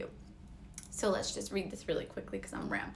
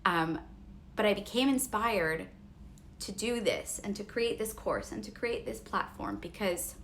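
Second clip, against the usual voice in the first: ordinary talk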